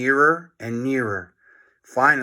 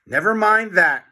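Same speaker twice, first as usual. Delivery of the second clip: urgent cry; 6 semitones higher, 5 dB louder than usual. A narrator's reading. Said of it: "cried George".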